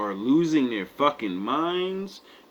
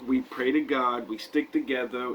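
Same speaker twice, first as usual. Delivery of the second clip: usual speaking voice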